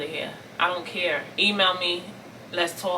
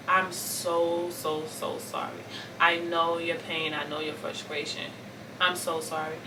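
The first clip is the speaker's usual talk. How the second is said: heartfelt sympathy; 5 dB quieter, slower than usual. Real, not acted. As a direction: console gently, drawing out each "so". Consoling daughters going through the same.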